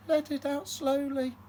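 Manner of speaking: high, strained on a held breath; holding in breath. Acted. Mum guiding dragon breathing, high held-breath voice. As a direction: speak while holding a big breath in.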